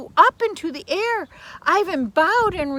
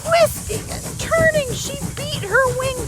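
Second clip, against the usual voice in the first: higher, louder, urgent narration; dramatic; 12 semitones higher, 7 dB louder than usual. A narrator's reading. Narrating the firebird's frantic fight, high and urgent. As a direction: race through the struggle.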